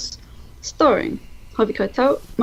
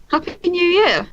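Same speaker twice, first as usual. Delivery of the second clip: festive greeting; 9 semitones higher, slower than usual. Real, not acted.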